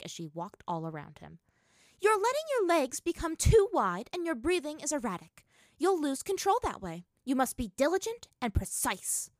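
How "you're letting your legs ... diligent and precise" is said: high, loud drilling critique; strict coach, voice pitched up and loud; sharp scolding. A narrator's reading. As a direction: berate the pupil's form. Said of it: "Azula shot at her brother".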